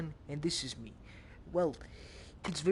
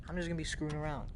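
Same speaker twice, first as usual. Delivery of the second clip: ordinary talk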